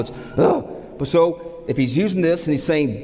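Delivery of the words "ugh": high, sudden "ugh" of complaint; grumbling grunt. Shown as a demonstration. Preacher demonstrating the sound of a grumble, high grumbling grunt. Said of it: "the sound of a grumble".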